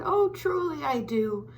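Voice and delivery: a hint of a jolly uncle hooting. Uncle Fred insists he loves it.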